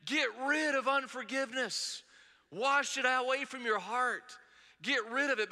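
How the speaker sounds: acted yell to a crowd of followers; imagined yelling. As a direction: urge it as a high, emphatic command. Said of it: "it's like Jesus yelling at us".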